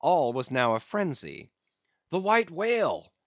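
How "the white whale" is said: higher pitched cry of sighting, louder on the second; excited cry. Acted. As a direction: call out the whale sighting, rising to a shout. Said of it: "was the cry from the captain".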